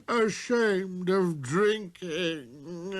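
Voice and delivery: put-on very slow, crying drunk voice; a broken sob of shame; tearful tippler. Tippler sobs.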